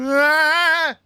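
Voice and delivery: sudden high loud yell of frustration; frustrated shout. Real, not acted. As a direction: yell out at your own flub. Frustrated at own delivery.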